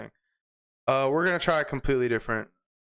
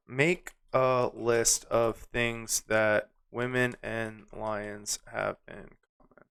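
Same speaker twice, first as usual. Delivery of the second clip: slow, spaced words, fading softer at the end; typing aloud; 2 semitones lower, 3 dB quieter, slower than usual. Real, not acted. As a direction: dictate it slowly to yourself while typing. Types the request while saying it.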